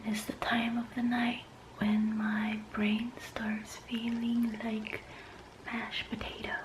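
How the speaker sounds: hushed, voiceless whisper; real whisper. Real, not acted. Vlogger signing off late while Mommy is sleeping, whispering. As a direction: whisper very softly into the mic. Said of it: "I'm whispering now. Mommy is sleeping".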